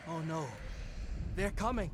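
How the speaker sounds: sudden fear as they approach; alarmed. Acted.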